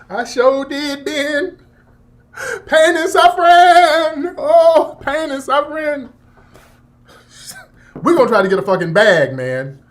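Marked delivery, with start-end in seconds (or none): 0.1-6.1 high, sung, hammed-up cry of pain; mock wailing. Plaintiff to his lawyer, hammed-up high wailing. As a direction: wail it like a fake victim.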